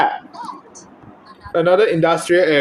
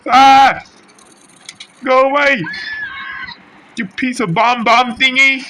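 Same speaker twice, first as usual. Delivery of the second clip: very high, loud panicked screaming; shrieking in panic; 11 semitones higher, 7 dB louder than usual. Real, not acted. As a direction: scream as the bomb thing chases you. Chased by bomb enemy.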